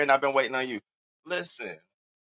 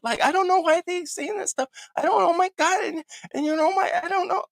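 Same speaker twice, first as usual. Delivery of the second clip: high, squeaky mock of a flustered woman; mocking imitation. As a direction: parody her nervous rambling defence. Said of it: "she was like".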